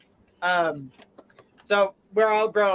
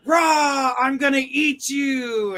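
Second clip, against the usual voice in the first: mock roar, higher and louder, slow; wolf roar; 5 semitones higher, 3 dB louder, slower than usual. The big bad wolf jumping out at Red, with a hammed-up roar. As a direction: pounce with a hammed-up growl. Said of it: "jumps out and says".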